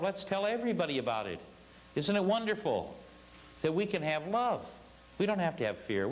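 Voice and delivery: eager and pitched high throughout; enthusiastic. Retold or imitated. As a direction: share the good news with delighted excitement. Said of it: "That kind of enthusiastic".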